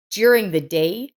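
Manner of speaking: ordinary delivery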